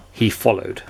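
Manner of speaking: ordinary delivery, nothing marked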